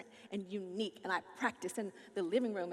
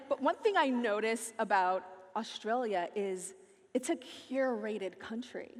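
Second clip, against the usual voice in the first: ordinary talk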